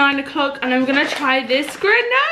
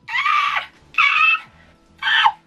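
very high piercing shrieks; excited shrieks; much slower than usual. Real, not acted. Thrilled about her photo shoot, high giddy shrieks. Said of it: "Those are really horrible noises".

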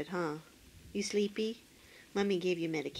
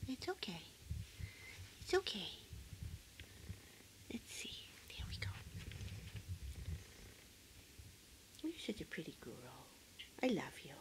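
soothing; 3 semitones lower, 12 dB quieter, faster than usual. Real, not acted. Soothing the pet.

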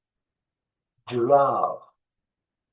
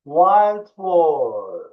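loud, higher "Wild boar"; raised teaching voice, long vowels; louder voice; 4 semitones higher, 7 dB louder than usual. Shown as a demonstration. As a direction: call the words out for pupils to echo. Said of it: "Biraz daha yüksek bir sesle".

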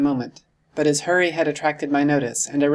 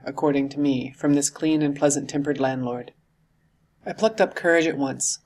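usual speaking voice

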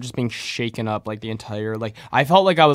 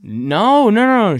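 high, loud rapid no's; emphatic denial; 10 semitones higher, 9 dB louder, slower than usual. Real, not acted. Correcting his friend about his mom, high and loud. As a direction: shoot the idea down, pitched way up.

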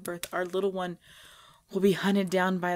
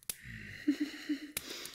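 dark chuckle; 11 dB quieter than usual. Acted. Vampire fighting her hunger.

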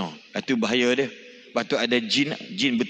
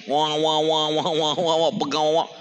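put-on baby crying; 4 semitones higher than usual. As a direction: mimic a bawling infant. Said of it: "Anak dia menangis".

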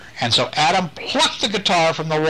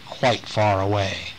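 ordinary delivery, nothing marked

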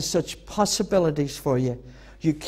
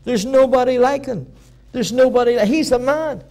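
proud God praising John; 6 semitones higher than usual. Retold or imitated. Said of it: "He said".

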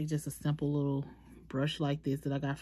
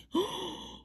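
sharp high gasp; surprised gasp; 14 semitones higher, 4 dB quieter than usual. Real, not acted. Thrilled at her own nail art, gasping. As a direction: catch your breath in delight. Sees design worked.

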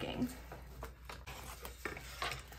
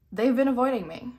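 louder, playfully aggrieved; mock grievance; 9 dB louder than usual. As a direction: complain to the cards in mock offence. Mock complaint about missing cards.

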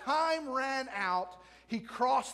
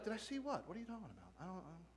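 very soft, dropping lower and fading; puzzled mumbled reply; 3 semitones lower, 15 dB quieter, much faster than usual. Retold or imitated. Pastor answering an excited fan, baffled and very quiet. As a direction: trail off quietly, acting baffled.

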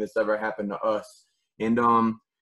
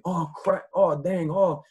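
imitating a player's dismayed groan, pitched higher; a light, higher mock "oh crap" after a miss; 7 semitones higher, slower than usual. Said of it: "because they're not thinking".

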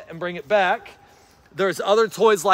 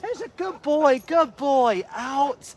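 higher, sing-song dog praise; happy praise; 7 semitones higher, slower than usual. Shown as a demonstration. Trainer praising his poodle in a happy high voice. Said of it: "You know, be excited".

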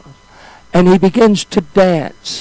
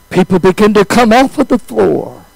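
ordinary delivery

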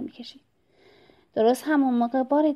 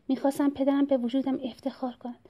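plain reading voice

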